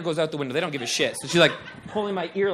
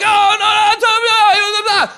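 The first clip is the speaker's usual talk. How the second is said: high, loud group freak-out; mock panicked screaming; 11 dB louder than usual. Friends reacting to a bug from an ear, mock screaming.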